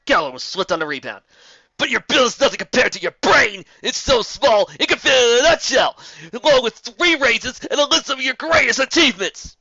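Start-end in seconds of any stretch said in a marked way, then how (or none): 1.8-9.6 loud, higher bellowed taunt; loud mocking shout; shouted insult. A narrator's reading. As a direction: roar the insult for the crowd.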